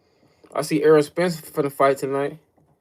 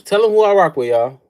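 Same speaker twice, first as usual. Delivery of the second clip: shouting it to the chat, louder each time; hyped callout; 4 semitones higher, 6 dB louder than usual. Real, not acted. Streamer shouting to his chat who he rides with. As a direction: yell it out to everyone.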